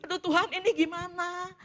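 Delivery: high-pitched, distressed wail to God; distressed appeal. Retold or imitated. Retelling her desperate appeal to God.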